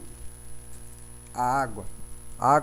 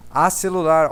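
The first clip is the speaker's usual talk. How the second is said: mock wrong usage; 4 semitones higher, 9 dB louder, slower than usual. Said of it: "Alguém já falou pra você".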